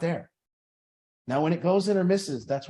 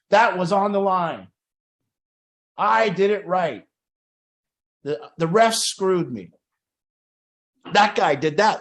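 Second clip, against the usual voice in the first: indignant mock protest, higher and louder; blaming the ref; 4 semitones higher, 6 dB louder than usual. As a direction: insist it was someone else's fault. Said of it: "some people just blame other people".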